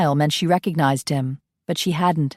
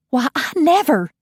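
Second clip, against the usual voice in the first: be affronted like a Southern lady; scandalised belle; 9 semitones higher than usual. Acted. Coralie shocked by Ian's remark, high scandalised belle.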